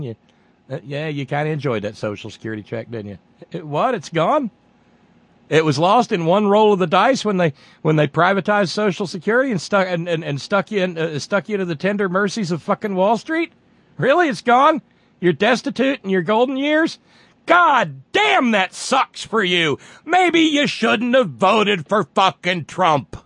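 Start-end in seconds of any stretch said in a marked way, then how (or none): 3.5-17.0 high-pitched sarcastic disbelief; mock astonishment. Mock astonishment at imagined voters. 17.5-23.2 high, slow, sarcastic shout; scornful mock sympathy. Taunting imagined Trump voters.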